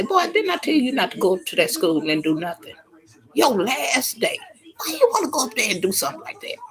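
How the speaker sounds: sharp scolding that rises to a shout; scolding mom. Retold or imitated. Mother scolds her son for trouble at school, rising to a shout. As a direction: tear into the boy like a fed-up mother.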